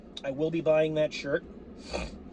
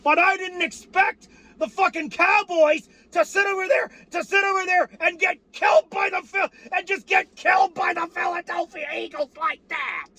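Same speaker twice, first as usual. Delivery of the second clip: furious shout; 12 semitones higher than usual. Real, not acted. Fan yelling at the Cowboys for getting crushed, screaming. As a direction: scream it at the top of your lungs.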